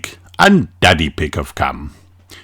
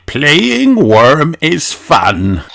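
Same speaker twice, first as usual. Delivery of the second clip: higher, slow, coaxing grandpa; Grandpa Pig voice; 7 semitones higher, much slower than usual. A narrator's reading. Grandpa coaxing George to play, higher and slow. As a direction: slowly coax the little one into the game. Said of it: "says Grandpa Pig".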